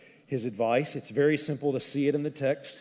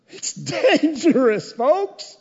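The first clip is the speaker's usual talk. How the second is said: high, loud, slow "dangerous" pressed out; loud warning; 11 semitones higher, 6 dB louder, much slower than usual. Shown as a demonstration. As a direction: hammer the warning at the congregation. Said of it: "loud repeat of earlier warning".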